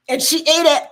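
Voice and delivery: real disgusted outburst; disgusted shout. Real, not acted. Disgusted retelling of scab story.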